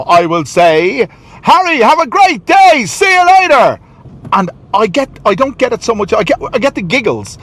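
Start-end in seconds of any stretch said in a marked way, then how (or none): 1.4-3.8 articulated shout. Retold or imitated. Dad calling out the car window to his son, loud and high. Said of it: "I'll articulate out the window".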